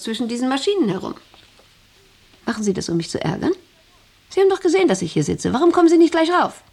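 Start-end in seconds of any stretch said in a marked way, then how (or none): 4.3-6.6 high, louder, fast reproach; irritated scolding. Acted. Boss scolds the wife for not coming up, sharp and loud. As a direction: snap at her for ignoring you.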